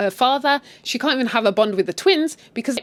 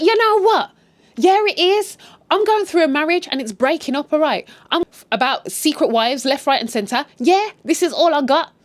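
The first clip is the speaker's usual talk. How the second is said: high, loud fed-up rant; cracking under stress; 7 semitones higher, 3 dB louder than usual. Retold or imitated. Stressed Joyce tells them off and walks out, fed up. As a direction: act out someone snapping at critics. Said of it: "I think she just cracked".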